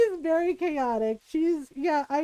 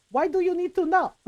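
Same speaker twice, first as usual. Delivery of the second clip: mock stern mom; 5 semitones higher than usual. Mom confronting nosy son.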